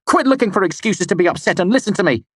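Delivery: fast, loud, high snap; snapped. A narrator's reading. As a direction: cut her off sharply. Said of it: "I snapped".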